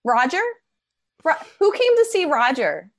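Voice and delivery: high, singsong questioning; talking to a toddler. Acted. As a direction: quiz a heckler like a small child. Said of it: "talk to them the way somebody might talk to a toddler".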